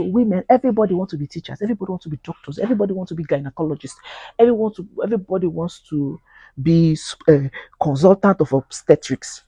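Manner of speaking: ordinary delivery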